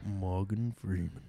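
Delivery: low, slow narrator-style impression; Morgan Freeman. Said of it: "That was my Morgan Freeman impression".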